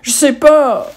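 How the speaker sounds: put-on a boy bursting into tears at his catechism exam; crying out. A narrator's reading. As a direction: wail it out like a cornered child in tears. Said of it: "l'enfant se met à pleurer en criant".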